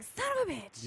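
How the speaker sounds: high, frustrated mutter to self; exasperated inner voice. Retold or imitated. Mock regret after taking chance.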